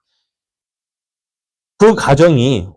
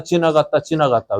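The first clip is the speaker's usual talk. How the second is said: each 지나갔다 softer, dying away; fading refrain; 3 semitones higher, 4 dB quieter than usual. Real, not acted. Preacher echoing the journey, fading softly. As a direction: let 지나갔다 fade out.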